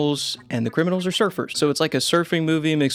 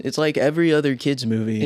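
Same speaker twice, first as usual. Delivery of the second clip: ordinary talk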